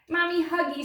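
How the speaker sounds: put-on a toddler demanding mommy; mild baby scream. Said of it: "screamed the baby".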